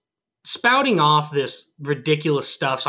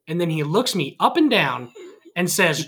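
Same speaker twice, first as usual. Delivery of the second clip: ordinary delivery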